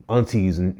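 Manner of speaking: normal talking voice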